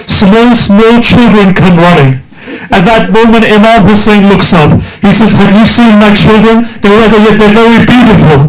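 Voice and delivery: high, rising sermon climax; impassioned preaching. Acted. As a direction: lift the congregation toward tears.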